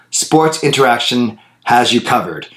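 ordinary talk

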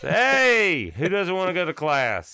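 high, loud cheerleader rally cry; pep rally hype. Retold or imitated. Pep rally leader hyping the students, high and loud. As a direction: whip up the crowd.

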